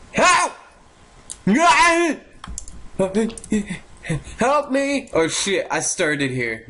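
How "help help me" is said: cry for help. Pretend. Gamer chased in a game, panicked cries. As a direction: call out high for rescue.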